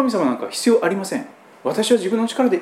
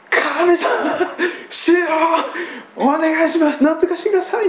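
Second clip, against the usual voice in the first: higher, urgent plea to God; bold pleading appeal; 9 semitones higher than usual. Retold or imitated. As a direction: press the request eagerly, voice raised. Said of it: "非常に積極的なアピール". (積極的に声を張り上げて祈る)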